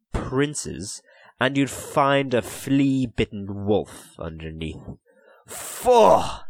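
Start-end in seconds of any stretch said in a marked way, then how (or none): 5.5-6.5 a sharp high snort of disgust. Acted. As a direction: spit it out in revulsion. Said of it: "he said disgustedly".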